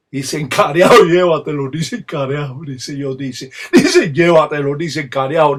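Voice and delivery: forceful chant bursting into loud cries; emphatic ritual invocation. Shown as a demonstration. Palero demonstrating the religion's emphases, forceful ritual invocation. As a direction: hurl out each ritual phrase, punching it loud. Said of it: "estas énfasis que se utilizan en la religión".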